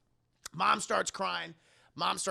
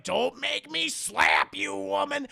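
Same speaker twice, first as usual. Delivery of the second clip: raised, slow, emphatic warning; mock angry threat; 7 semitones higher, 4 dB louder, slower than usual. Dad threatens mother mid-quarrel, angry warning. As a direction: threaten slowly at a raised volume.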